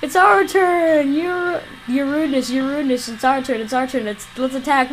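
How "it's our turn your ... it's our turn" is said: excited minion cheer. Acted.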